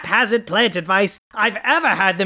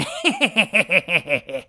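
evil cackle. Acted. Villain laughs at his scheme.

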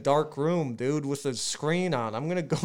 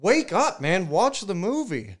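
high-pitched, insistent plea to wake up; exasperated wake-up call, jumping high on the first words; urgent waking-up; 4 semitones higher than usual. Retold or imitated. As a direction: shake a sleeping friend awake with your voice. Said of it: "was shaking me awake".

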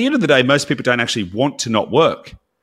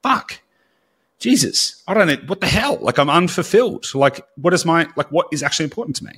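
high-pitched dismayed self-questioning; mock existential exasperation, pitched up; exasperated realization; 6 semitones higher than usual. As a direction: act out someone suddenly dismayed. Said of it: "and then go".

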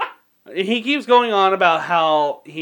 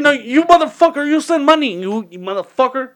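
high, louder mock tirade, easing at the end; imitated angry yelling; 7 semitones higher, 3 dB louder than usual. Spell caster demanding money, yelling. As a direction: act out an irate scammer cursing. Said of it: "he starts yelling at you".

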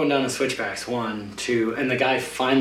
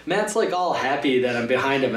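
ordinary talk

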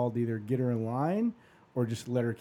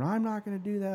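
high-pitched imitation of a stubborn mom; mock a dug-in refusal, pitched up; 9 semitones higher than usual. Mom refusing the angiogram, mock stubborn voice.

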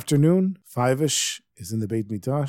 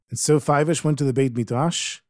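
plain reading voice

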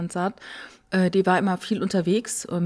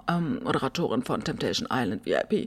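low creaky vocal fry, softer; 8 semitones lower, 8 dB quieter than usual. Shown as a demonstration. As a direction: let the voice crackle into fry. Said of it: "Mach mal vor, bitte".

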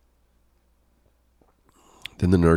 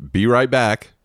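ordinary talk

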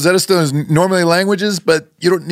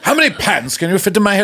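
higher, posh British demonstration; James Mason; 3 semitones higher than usual. Shown as a demonstration. Coach shows student the accent, posh James Mason impression. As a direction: put on a suave film-star English accent. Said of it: "like a James Mason thing".

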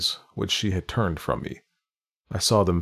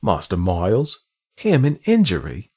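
flaring indignation; 5 dB louder than usual. Acted. Housekeeper defending the boy to the governess, indignant flare. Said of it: "she suddenly flamed up".

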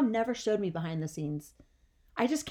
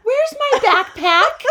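put-on very high, loud, stretched-out kid holler; demanding child; 12 semitones higher, 11 dB louder, much slower than usual. Kid to crying mom through door, high drawn-out holler. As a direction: yell it through the door, half laughing.

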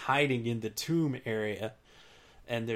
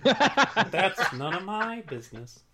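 Kermit the Frog; 3 semitones higher than usual. Pretend. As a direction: sing it out like Kermit. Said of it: "you wanna hear it mean".

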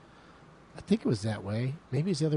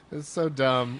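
ordinary talk

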